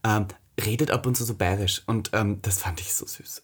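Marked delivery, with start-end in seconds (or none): 2.4-3.4 whispered, softer, lower fondness; soft gush. Real, not acted. Telling a friend about a sweet date, whispered. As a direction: breathe it out, charmed.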